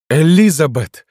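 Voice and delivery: high, loud desperate cry; shouting. A narrator's reading. Rejected lover cries to the woman, shouting. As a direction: scream her name after her. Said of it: "закричал Дентон".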